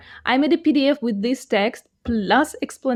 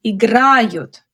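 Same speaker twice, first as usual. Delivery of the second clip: "Играют" with stress on the middle, -гра-; stressed middle syllable. Shown as a demonstration. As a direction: lean on the second syllable of "Играют". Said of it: "The stress falls differently in each one".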